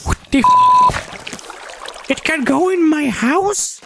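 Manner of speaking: squeaky high-pitched disbelief, slowed; shocked squeal. Real, not acted. Seeing a spider get into her house, a squeaky shocked shriek.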